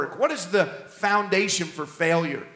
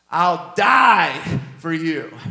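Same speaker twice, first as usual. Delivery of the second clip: loud, much higher cocky vow with a laugh, slowing at the end; swaggering Peter; 3 semitones higher, 6 dB louder, slower than usual. Retold or imitated. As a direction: shout it like he can't fail. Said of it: "He says".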